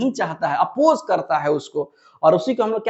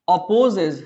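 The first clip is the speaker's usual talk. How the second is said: slow, spaced-out dictation voice, pausing between words; much slower than usual. Acted. Teacher dictating a definition to students, slow and spaced. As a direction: read the definition out word by word for notes.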